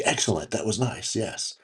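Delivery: soft, low "excellent" like a scheming boss; bald schemer impression. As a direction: savor each word like a bald villain. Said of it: "If I had hair, I'd be like".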